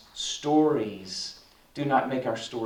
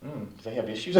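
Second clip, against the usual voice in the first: ordinary talk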